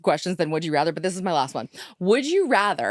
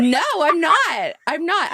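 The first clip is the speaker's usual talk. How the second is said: defensive; 8 semitones higher, 5 dB louder, slower than usual. Real, not acted. Defensive protest.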